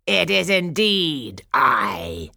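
loud, slow, gloating villainess; cackling wicked fairy. A narrator's reading. Wicked fairy reveals herself to the court, cackling. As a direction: draw it out with wicked relish. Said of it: "cackled the wicked fairy".

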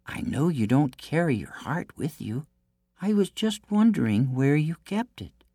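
high princess voice, fishing for a secret; coaxing princess. A narrator's reading. Princess asks the giant about his heart, coaxing high. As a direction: ask innocently, pitched high.

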